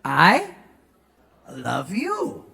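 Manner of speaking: tender English way; proper soft tune. Shown as a demonstration. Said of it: "Nadanya harus".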